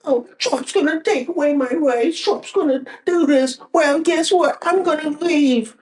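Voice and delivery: mock whiny liberal. Vlogger mocking TikTok liberals in a high whiny sob voice. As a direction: whine it like a crybaby you're mocking. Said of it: "they whine, complain, they go".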